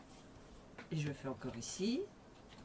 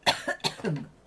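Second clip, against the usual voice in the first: dry breathy cough; a rough unvoiced cough; coughing fit; 6 dB louder than usual. Real, not acted. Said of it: "je tousse, je tousse".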